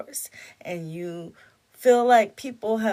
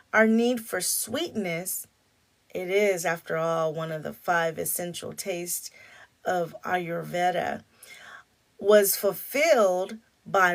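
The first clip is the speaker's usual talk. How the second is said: reading aloud; 5 dB louder than usual. Real, not acted. Reads book passage aloud.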